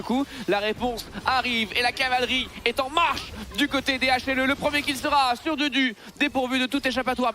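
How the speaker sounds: high, rising play-by-play hype; esports caster. Real, not acted. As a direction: build the charge of the cavalry. Caster narrating counter-engage.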